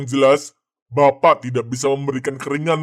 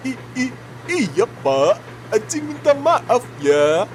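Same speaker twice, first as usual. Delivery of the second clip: high, stammering meek voice; high-pitched squeaky underling who is apologising to the boss; timid intern; 6 semitones higher than usual. Acted. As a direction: stutter nervously like a scared young intern. Intern Acing's timid put-on voice.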